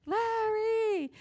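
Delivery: high, drawn-out call across the yard; calling her husband's name from outside. Retold or imitated.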